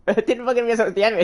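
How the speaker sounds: high, fast, laughing at himself; excited disbelief. Real, not acted. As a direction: blurt it out in disbelief.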